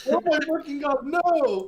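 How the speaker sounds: high, loud horrified protest; horrified outcry. Real, not acted. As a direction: shriek in disbelief. Horrified at the prayer.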